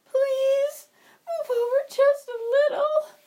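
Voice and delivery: weep the words like a tired little bird; crying sparrow. Acted. Tired wet sparrow cries to be let in, tearful and high.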